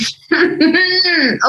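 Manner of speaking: high, squirming smitten laugh; giddy giggle. Acted. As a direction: giggle while holding in a secret. Therapist giggling before confessing.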